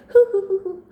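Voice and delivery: high breathy fake sobbing; mock sob. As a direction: pretend to sob like the girl.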